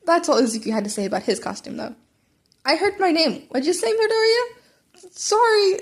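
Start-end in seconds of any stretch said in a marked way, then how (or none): 2.6-5.1 high, slow, easygoing classmate call; Kirishima's voice. Acted. Classmate calls over to Izuku, high and drawn out. 5.1-5.8 stammered apology. Acted. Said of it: "Izuku stammered".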